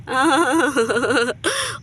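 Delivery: drawn-out villainous chuckle; gloating laugh. Acted. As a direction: laugh in smug triumph at the plot. Villains gloat over their plot.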